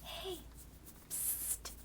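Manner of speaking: high, hushed psst over the fence; whispered call. Acted. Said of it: "You hear someone whisper".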